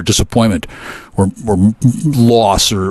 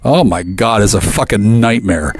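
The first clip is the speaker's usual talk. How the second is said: ordinary delivery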